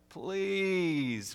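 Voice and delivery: high, drawn-out plea; wheedling, high-pitched pleading; begging. A narrator's reading. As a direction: beg in a high put-on voice. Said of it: "begged the bird".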